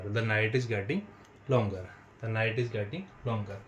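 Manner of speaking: slow, drawn-out words; slow dictation. Shown as a demonstration. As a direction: dictate it slowly. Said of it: "teacher dictating slowly". (धीरे-धीरे लिखवाना)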